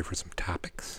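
whispered cue. Real, not acted. Host whispering to co-host that topic time is here. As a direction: breathe the question like a stage cue. Cueing the next podcast segment.

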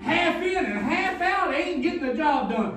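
slow, loud, half-sung preaching; preaching at full force. Acted. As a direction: chant it out like a pulpit climax. Preacher quoting Jesus, sung.

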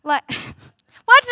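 a laugh breaking into a high, loud what; incredulous laugh at the absurd complaint; laughing disbelief. Real, not acted.

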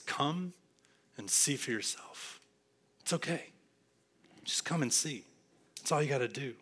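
soft, unhurried invitation; gentle reassurance. Acted. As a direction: invite them in very quietly.

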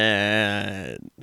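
long drawn-out, low mock shout; Johnny Horn. Host quoting a character's random shout in the episode, drawn-out holler. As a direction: imitate a random holler, holding the sound. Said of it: "Johnny Horn randomly shouts".

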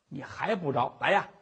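ordinary delivery, nothing marked

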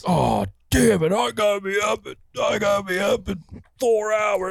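high, whiny mock complaint; frustrated whine. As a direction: whine like a hungover early riser. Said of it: "and then be like".

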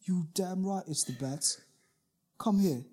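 high, hammed-up British geezer voice; squeaky mock London hard man, bruv; bad British accent. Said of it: "Can't do a British accent".

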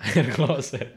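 laughing while repeating the joke; words bursting out through laughter. Real, not acted.

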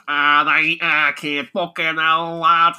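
loud, exaggerated Mancunian; Manchester accent. Mimicking the game's main guy, broad Manchester accent. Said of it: "Lancashire slash Manchester accent".

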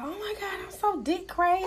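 imitating giddy girls; mocking girly voice. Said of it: "how these girls be like".

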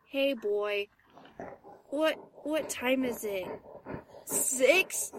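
low, soft, just-woken mumble; barely awake, struggle to get words out; groggy. Acted. Owner woken at six by dog, groggy.

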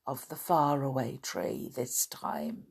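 whispered, hushed wonder. Acted. Children wondering about the land above, whispered. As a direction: breathe it out in awe.

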